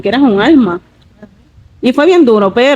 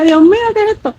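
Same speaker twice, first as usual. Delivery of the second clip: retold fright; 11 semitones higher, faster than usual. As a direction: relive a scare in a high voice. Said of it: "Yo decía, más me asusté". (susto recreado con voz aguda)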